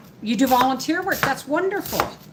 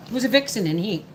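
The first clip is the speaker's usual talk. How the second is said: normal talking voice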